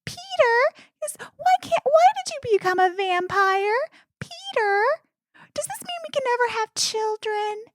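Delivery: high, nagging wife voice with a laugh; mock whiny wife. Mocking the wife's nagging questions, high and whiny.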